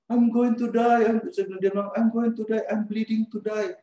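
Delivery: high, loud terrified wail; panicked cry. Retold or imitated. Carl in the cab crying that he will bleed to death, high panicked wail. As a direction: cry out like you're sure you'll die. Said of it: "Si Carl dia di dalam".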